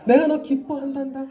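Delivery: sugary tender voice; sweetly. Shown as a demonstration. Preacher softly doting on a boy named Hoseon. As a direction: coo it like a doting father. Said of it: "좀 더 달달하게".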